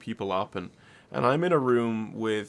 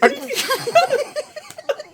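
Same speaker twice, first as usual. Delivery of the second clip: high, loud burst of laughter; 5 dB louder than usual. Real, not acted. Laughing at the story.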